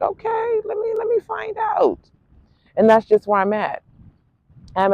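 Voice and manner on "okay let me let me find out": much higher, quiet impressed interviewer. Retold or imitated. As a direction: squeak it like a stunned boss. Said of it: "you can be like".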